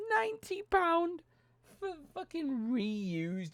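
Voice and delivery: a high, squeaky gasp of price outrage. Real, not acted.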